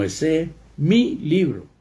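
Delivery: "mi" stressed high and strong, apart from "libro"; emphatic possessive MI. Shown as a demonstration. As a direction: insist on ownership by leaning hard on "mi". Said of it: "recalcar sobre que es de mi propiedad".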